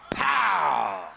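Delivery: loud, high shouted "Pow!" for the blow; sharp shouted impact sound. Retold or imitated. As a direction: bang it out like a fist on wood. Pounding the casket.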